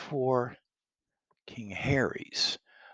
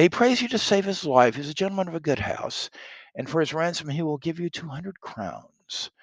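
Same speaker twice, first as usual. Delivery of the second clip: higher-pitched reading, emphatic at the start; boy interpreter; 6 semitones higher, 7 dB louder than usual. Acted. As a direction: pass on the plea as a young interpreter. Reads the boy translating for Pistol.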